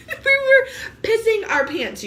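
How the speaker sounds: can barely get it out for laughing; laughing hard. Real, not acted. Recalling laughing with friend.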